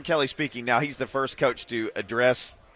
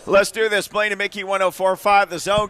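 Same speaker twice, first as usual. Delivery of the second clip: higher, louder radio kickoff; hyped show open; 7 semitones higher, 4 dB louder than usual. Acted. Host kicks off the show for listeners, pumped-up open.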